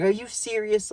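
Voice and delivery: hammed-up disbelief in her voice; imitating a shocked girl. Girl's quote, said higher.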